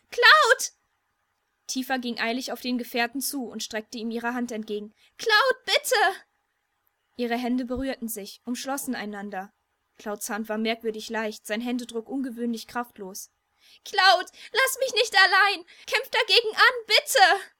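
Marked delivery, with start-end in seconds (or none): 0.1-0.7 high, loud scream of alarm; panicked scream. Acted. Cloud starts to fade away. 5.2-6.2 high, loud desperate cry; desperate plea. Acted. Cloud vanishing before her. 13.8-17.5 high, loud, voice cracking plea; voice breaking. Acted. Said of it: "Tifas Stimme überschlug sich".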